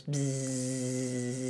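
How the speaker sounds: long steady bee buzz; low, sustained bzzz. Acted. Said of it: "And that bee goes".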